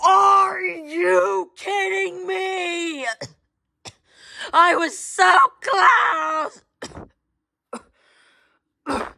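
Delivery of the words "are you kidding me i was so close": high, slow, drawn-out wailing yell; raging gamer shout. Acted. Gamer who was so close to winning, raging shout. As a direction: scream it in outraged disbelief, stretching the words. Rage after losing the match.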